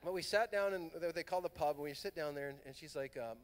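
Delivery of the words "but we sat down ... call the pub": usual speaking voice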